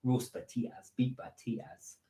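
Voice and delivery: soft, measured Geordie food list; quietly demonstrated Geordie accent. Shown as a demonstration. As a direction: list potato dishes in Geordie. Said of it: "if you wanna do a Geordie accent".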